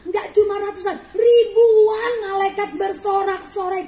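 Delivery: high, loud cry to Mama; excited shout, pitched well up; retold child's shout. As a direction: imitate a child calling out in wonder, drawn out. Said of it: "anak saya teriak lagi".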